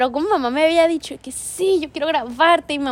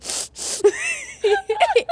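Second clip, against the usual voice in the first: high shrieking laugh-cry. Retold or imitated. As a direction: squeal between laughing and sobbing. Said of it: "y yo de que". (carcajada aguda como llanto)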